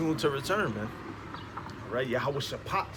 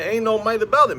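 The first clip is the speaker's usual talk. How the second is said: emphatic; 8 semitones higher, 9 dB louder than usual. Real, not acted. Emphatic rebuttal while preaching.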